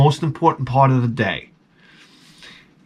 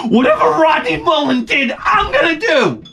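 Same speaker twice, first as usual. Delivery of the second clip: put-on much higher, hyped kid yell; excited shout; 13 semitones higher than usual. Fan recalling his skating hero, excited yell. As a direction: yell it like a starstruck young skater. Idolizing Rodney Mullen as a kid.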